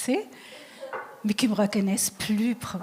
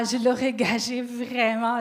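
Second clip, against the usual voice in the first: ordinary delivery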